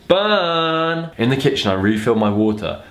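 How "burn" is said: high, drawn-out mock shout; teasing shout, pitched well up. A narrator's reading. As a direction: call it out like a jeering mate.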